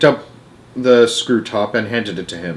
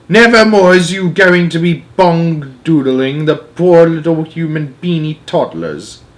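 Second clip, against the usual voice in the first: slow, louder then fading; stern vow; 5 semitones higher, 5 dB louder, slower than usual. A narrator's reading. Giant vows to the nightmare in the jar, slow and stern. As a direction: pronounce it firmly. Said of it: "he cried".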